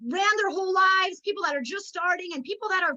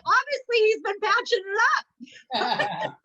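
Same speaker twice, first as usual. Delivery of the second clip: slow laughing joke; 5 semitones higher, 3 dB louder, slower than usual. Retold or imitated. Joking reply to the compliment.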